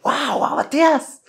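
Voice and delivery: high, slow delighted cry of the rabbi's name; joyful greeting. Retold or imitated. Father spotting the rabbi, calling out with joy. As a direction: call out in glad surprise.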